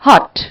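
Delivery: हॉट said as one clear beat for a clap; syllable demo. Shown as a demonstration. Teacher to students, clapping out हॉट as one syllable. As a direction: sound out हॉट as a single syllable, loud. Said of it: "तो मैं बोल रही हूं".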